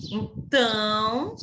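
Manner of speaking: ordinary delivery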